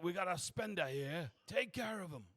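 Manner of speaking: high-pitched call to a colleague; eager doorman voice; doorman announcing a customer. Acted.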